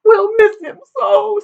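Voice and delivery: very high, hammed-up wail; weeping. A narrator's reading. Daughter joins her parents in grief, weeping. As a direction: bawl it in a tiny high voice. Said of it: "was in tears".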